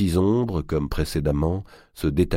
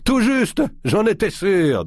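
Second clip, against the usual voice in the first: booming mocking giant; 5 dB louder, slower than usual.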